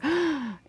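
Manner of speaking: sharp theatrical intake of breath; mock gasp. Host feigning shock at a forbidden question, mock gasp.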